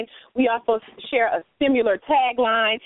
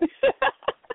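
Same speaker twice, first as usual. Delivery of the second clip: high laugh. Real, not acted. Laughing at the joke.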